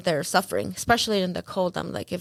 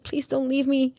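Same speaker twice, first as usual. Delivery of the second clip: retold plea, pitched up; begging; 7 semitones higher than usual. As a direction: act out a mild, high-voiced plea.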